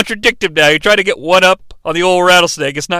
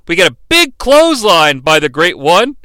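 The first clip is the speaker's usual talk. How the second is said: usual speaking voice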